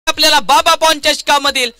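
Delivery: normal talking voice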